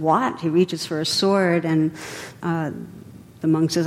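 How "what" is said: usual speaking voice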